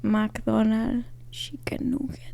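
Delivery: breathy mock whisper into an ear. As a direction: whisper it like a secret meme line. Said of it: "he's whispering in someone's ear".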